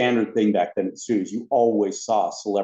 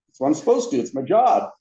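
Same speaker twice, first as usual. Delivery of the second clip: higher, animated self-justification; mock defensive; 6 semitones higher, 3 dB louder than usual. Ride host defends himself to bosses, high mock-defensive protest. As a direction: protest in a raised pitch.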